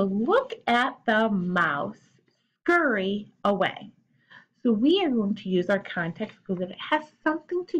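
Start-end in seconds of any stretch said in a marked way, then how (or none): 0.0-3.9 slow reread. Shown as a demonstration. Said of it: "use hints or context clues".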